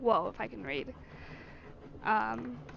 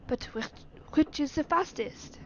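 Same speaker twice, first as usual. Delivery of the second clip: put-on slowing, rising French-accented question; 3 semitones higher than usual. Servant asking master for fastest route, French accent. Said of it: "French accent is so much easier".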